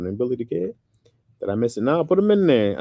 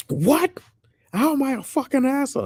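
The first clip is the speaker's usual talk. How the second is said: higher, louder mock outrage; going off; 3 dB louder than usual. Comedian snaps at a fan who called him rude, mock outrage. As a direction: retell the comedian snapping back, indignant. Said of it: "And Dane was going off".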